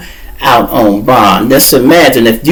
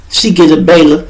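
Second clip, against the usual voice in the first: ordinary talk, nothing marked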